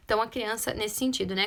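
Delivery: ordinary talk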